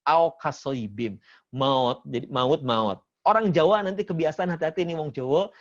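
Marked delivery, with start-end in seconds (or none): 0.0-3.0 slow mock Sundanese "maut" said as "maot". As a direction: stretch "maut" into an o sound, Sundanese style. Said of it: "kebiasaan U suka jadi O". (logat Sunda, "maut" jadi "maot" pelan)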